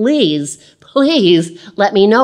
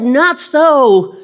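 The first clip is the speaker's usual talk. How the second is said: louder, higher protest; emphatic refusal; 8 semitones higher, 5 dB louder than usual. Acted. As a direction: push back hard. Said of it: "And Peter said".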